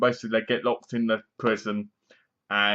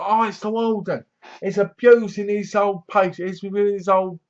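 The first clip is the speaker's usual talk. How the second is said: higher, a hint of outraged surprise; mock shocked viewer; 6 semitones higher than usual. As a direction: lightly gasp it out like you caught him. Viewer reacting to warden scene.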